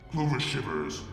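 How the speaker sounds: ordinary delivery, nothing marked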